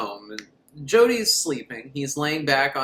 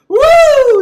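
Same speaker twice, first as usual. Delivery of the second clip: very high, loud whoop; wild mock celebration; excited whoop; 16 dB louder than usual. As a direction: cheer like leaping from a car. Jumping out car cheer.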